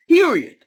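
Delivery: high, loud punch on "period"; emphatic full stop. Real, not acted. Commentator shutting down debate on what to watch, emphatic. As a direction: hit "period" hard and high, then let it fall.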